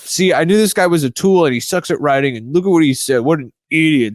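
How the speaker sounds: a hint of a mocking bro coach deriding a newbie's bike review. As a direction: sneer at the rookie like a know-it-all riding coach. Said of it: "the bro coach was just like".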